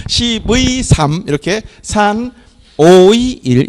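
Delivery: "십의 삼", "산 오의 일" spoken slowly; slow, deliberate reading with the dash as 의; model reading. Shown as a demonstration. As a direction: stretch the 의 in "십의" and "오의". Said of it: "이렇게 읽어주세요".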